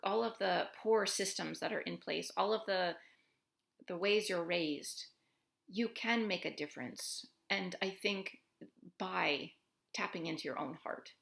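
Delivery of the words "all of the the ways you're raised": normal talking voice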